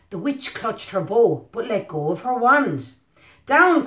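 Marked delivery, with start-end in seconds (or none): 3.5-3.8 loud, high shout of Down; cried out. A narrator's reading. Witch cries to the broom in the storm, loud shout. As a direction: call the broom down hard. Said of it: "cried the witch".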